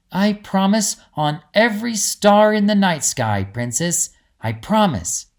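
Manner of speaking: lightly voiced fairy, reassuring; reassuring Greta. A narrator's reading. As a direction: comfort the princess before leaving. Greta promises Lizzie.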